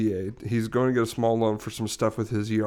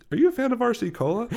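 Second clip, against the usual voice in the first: silly high question; 13 semitones higher, faster than usual. Retold or imitated. Joke question to bank clerk.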